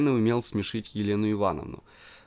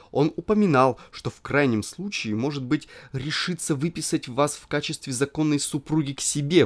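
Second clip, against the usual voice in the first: impassioned pleading; 3 semitones higher, 4 dB louder than usual. Acted. Narrator pleads passionately.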